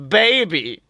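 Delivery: ordinary talk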